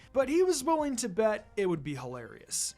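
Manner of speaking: ordinary delivery, nothing marked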